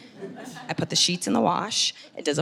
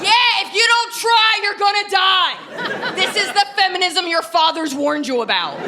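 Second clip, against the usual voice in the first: rally the crowd like a crazed warrior; laughing shout; 10 semitones higher, 5 dB louder than usual. Acted. Shouting the show's battle cry.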